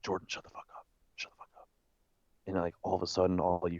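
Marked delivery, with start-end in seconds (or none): 0.0-1.7 urgent whisper; whispered hush. Retold or imitated. To Jordan under the bridge, an urgent whisper. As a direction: hiss him quiet in a whisper.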